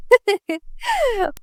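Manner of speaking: high giggle; teasing high-pitched laugh. Acted. Isra laughing at Yusuf's shyness, giggling.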